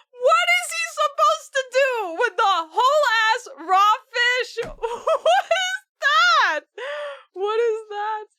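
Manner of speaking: high, loud, crying with laughter; laughing disbelief. Real, not acted. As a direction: shriek it through laughter. Laughing at the fish prize.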